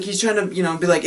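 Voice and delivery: ordinary talk, nothing marked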